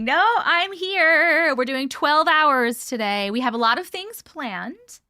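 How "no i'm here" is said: emphatic reassurance. Real, not acted. Answering viewers who doubted she's live.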